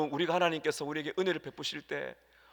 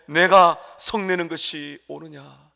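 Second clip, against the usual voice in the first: slow, louder divine question; voice of God; 8 dB louder, slower than usual. Acted. Pastor voicing God questioning Jonah, slow and louder. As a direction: speak it slowly as God asking. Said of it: "여호와께서 이르시되".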